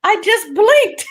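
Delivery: high, innocent mock mother voice; stubborn mom. Mother insisting she only blinked, mock innocent. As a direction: play the mother's innocent excuse.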